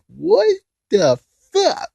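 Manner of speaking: high, slow, drawn-out disbelief; stunned disbelief. Real, not acted. Reviewer reacting to shock therapy for months, high incredulous squeak.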